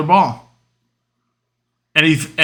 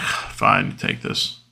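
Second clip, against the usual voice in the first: breathy, exasperated whisper; grudging surrender; 6 dB quieter, much slower than usual. Retold or imitated. Giving brother the plate, whispered grudging surrender. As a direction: give in under your breath, very softly.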